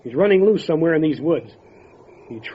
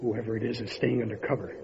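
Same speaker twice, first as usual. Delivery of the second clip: much softer, wary in the dark; tense hush; 4 semitones lower, 10 dB quieter than usual. Acted. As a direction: keep your voice down, something's out there. Lawmen whisper in the dark.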